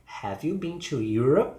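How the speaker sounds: "Europe" stressed and swept upward; yes-or-no question with emphasis on "Europe"; rising question. Shown as a demonstration. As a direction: lift the end of "Europe". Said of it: "emphasis is the final part".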